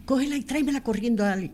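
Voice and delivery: high, rushed urgent request; urgent plea. Retold or imitated. A surgeon on the phone urging María to run. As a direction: hurry her along, it's urgent. Doctor urgently asks for x-ray.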